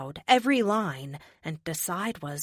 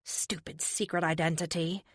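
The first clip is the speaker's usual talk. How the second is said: ordinary delivery, nothing marked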